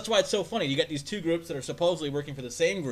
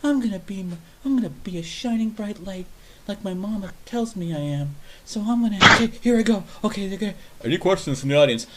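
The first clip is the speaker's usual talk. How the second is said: higher, louder self-pep talk; higher nervous pep talk before asking; nervous eager attendee; 6 semitones higher, 4 dB louder than usual. Retold or imitated. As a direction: psych yourself up like a shy guy. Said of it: "that's him trying to assert himself".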